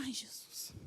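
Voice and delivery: breathy whispered "Ai, Jesus"; whispered gasp. Retold or imitated. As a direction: let it slip out as a hushed whisper. Startled reaction retold in story.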